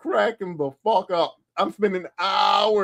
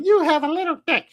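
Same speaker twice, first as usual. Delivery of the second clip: high, sneering degrading voice; imitating a JOI video woman; mocking dominatrix; 13 semitones higher than usual. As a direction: taunt him like a scornful mistress. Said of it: "women telling them".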